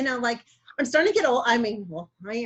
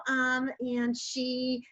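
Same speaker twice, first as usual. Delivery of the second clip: usual speaking voice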